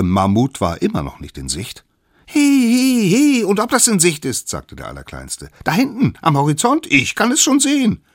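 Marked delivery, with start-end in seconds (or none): 2.2-4.5 very high, loud squeaky voice; high character voice, insistent and loud; protesting little one. Acted. Smallest one protests loudly. 5.6-8.0 high, loud squeaky voice; squeaky lookout shouting the sighting; excited little one. Acted. Smallest one points to the horizon.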